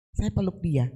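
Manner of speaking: ordinary delivery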